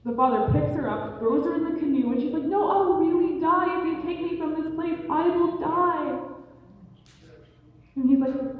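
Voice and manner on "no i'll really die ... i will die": high-pitched insistent pleading, slowing at the end; desperate protest. Acted. Girl begging not to be taken away, desperate protest. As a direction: plead in a high voice, dragging out the last words.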